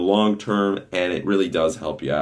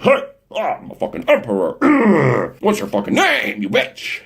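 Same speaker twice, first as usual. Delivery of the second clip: higher, swaggering mock bravado; cocky emperor guy; 14 semitones higher than usual. Mocking a no fap guy hitting on a woman, hammed-up swagger. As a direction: strut in like a self-declared emperor. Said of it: "A no fap would just go out".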